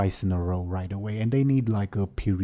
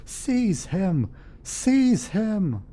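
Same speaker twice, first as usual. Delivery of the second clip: high, commanding shouted order; noble's yell; 11 semitones higher, 4 dB louder, much slower than usual. Acted. Game master as noble calling guards to seize the intruder, yelling. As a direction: cry out the order in alarm. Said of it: "the noble once again yells".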